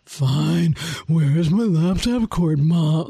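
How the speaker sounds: low, slow, drawn-out sulk; grudging teen. Retold or imitated. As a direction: imitate a kid giving in resentfully. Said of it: "often it's like".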